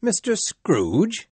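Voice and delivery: can it really be him; amazed. Acted. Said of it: "The man looked amazed".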